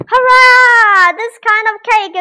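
high, loud happy cheer; excited cheer. Real, not acted. Cake is done.

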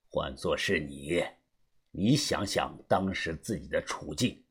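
put-on low older mentor, subdued; knowing elder, assured. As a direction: prompt the listener quietly to think. Voices Uncle Xu.

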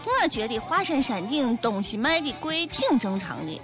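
normal talking voice

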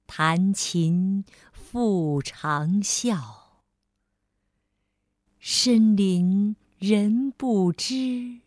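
very slow, solemn reading; slow lyrical recital fading away; poem recitation. Acted. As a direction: recite the classical poem slowly, lingering.

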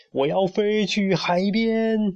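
high, stretched-out wild cry; crazed exclamation. A narrator's reading. Bewitched bird atop the barrel, crazed cry. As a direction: proclaim it rapturously, dragging the words. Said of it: "站在木桶上大声地喊叫".

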